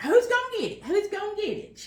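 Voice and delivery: put-on high, loud childish squabbling; kids' squabble. Kids fighting over the last chocolate milk sip, loud and high.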